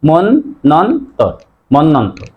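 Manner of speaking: মন নন তর in pieces, then মন্বন্তর whole; doubled n. Shown as a demonstration. Teacher shows doubled n, syllable by syllable. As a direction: break it as mon, non, tor, then join it. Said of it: "double হবে".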